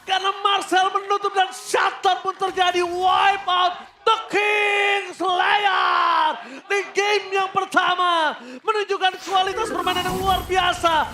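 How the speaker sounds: high, loud hype shouting; fighting game announcer. Acted. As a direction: scream the kills like an arena announcer. Caster shouting through teamfight.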